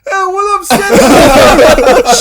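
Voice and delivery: high, loud, laughing hello; cheerful injured mate, hammed up; woozy greeting. Injured friend greeting Seth from the stretcher, high and giddy. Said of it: "he's like".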